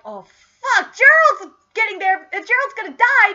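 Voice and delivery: rising, loud dawning panic; acted alarm. Kyle and Ike realising Gerald is in danger, alarmed.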